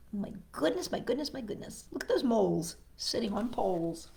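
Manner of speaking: ordinary delivery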